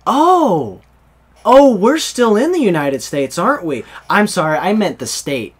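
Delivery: high, loud dawning realization, easing off; imitating cousin. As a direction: play a clueless cousin suddenly catching on. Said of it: "She's like".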